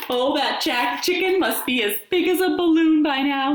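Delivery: wolf gloating to himself; gleeful wolf. A narrator's reading. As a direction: gloat over the fat chicken. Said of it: "he thought".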